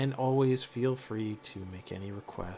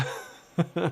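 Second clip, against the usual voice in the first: bright burst of laughter; 7 semitones higher, 5 dB louder than usual. Real, not acted. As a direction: laugh out loud. Laughs at cohost about to sneeze.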